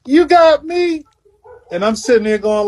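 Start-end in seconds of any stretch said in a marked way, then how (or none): 0.0-1.0 high-pitched mock French Lick tone; French Lick accent, pitched way up; Larry Bird. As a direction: imitate a taunting question in French Lick tone. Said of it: "his French lick tone".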